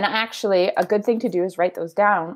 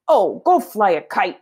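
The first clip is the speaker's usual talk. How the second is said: rereading with annoyance; 3 semitones higher, 6 dB louder than usual. Shown as a demonstration. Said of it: "I'm gonna pause there".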